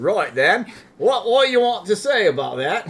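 loud, high put-on British voice; Irish-ish British accent. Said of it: "a little more Irish and a little less Michael Caine".

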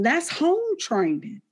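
That's home training, drawn out slow and high on home; emphatic punchline. Real, not acted. Mom preaching to the audience, slow high emphatic stress. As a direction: land home training slowly, pitch up.

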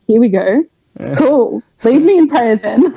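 mock sarcastic, rising pitch; sarcastic eye-roll delivery. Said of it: "really sarcastic".

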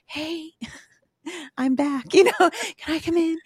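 high, sheepish mom at the door. Retold or imitated. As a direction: peek back in, tentative and half-laughing. Sheepish return to kids' door.

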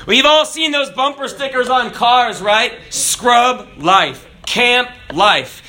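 ordinary talk

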